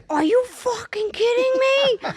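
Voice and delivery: high, slow, stretched disbelief; mock outrage. Agent hearing the buyer confessed bad credit, mock outrage. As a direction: squeal it in hammed-up shock. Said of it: "And I'd be like".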